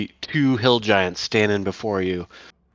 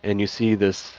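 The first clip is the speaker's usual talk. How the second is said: ordinary talk, nothing marked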